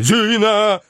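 high, loud call; frightened yell. A narrator's reading. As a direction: bawl in panic. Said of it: "орал испуганный Шариков".

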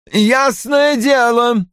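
high, slow exclamation; angry cry. A narrator's reading. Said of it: "вскричал Рой". (гневный вскрик)

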